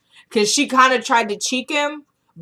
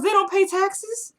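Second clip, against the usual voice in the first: much higher, slow, disbelieving; incredulous; 11 semitones higher, slower than usual. Retold or imitated. Husband doubting Marcy's tax-record plan, a high incredulous question. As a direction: let it climb in disbelief.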